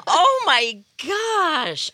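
high, slow and overcome; choked up. Real, not acted. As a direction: let the emotion choke it.

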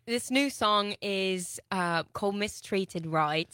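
put-on slow, drawn-out Australian accent. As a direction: slowly lay on the Australian accent. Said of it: "this is totally an Australian accent".

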